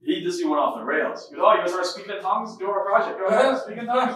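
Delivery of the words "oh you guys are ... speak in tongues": high, jeering imitation; mocking. Classmate jeering at tongues-speaking friend, mocking. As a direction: taunt him in a raised voice, egging him on. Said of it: "Like, mocking it hardcore".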